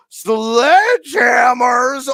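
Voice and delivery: slow, high, loud hype call; hyped shout. Acted. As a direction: drag it out like a stadium announcer. Hyping a viewer's question.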